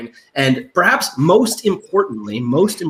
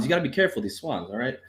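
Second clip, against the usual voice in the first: normal talking voice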